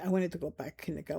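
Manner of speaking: ordinary talk, nothing marked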